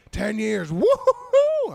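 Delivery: high, loud, drawn-out cheer; drunken heckler whoop. Retold or imitated. Drunk woman bragging of ten years together, shrieking cheer.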